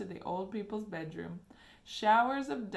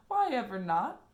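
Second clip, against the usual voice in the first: surprised; 3 semitones higher, 7 dB louder than usual. A narrator's reading. Wonka surprised question.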